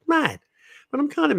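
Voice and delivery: squeaky mock outrage; high-pitched comic exclamation; high comic squeal. Real, not acted. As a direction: go way up for comic effect.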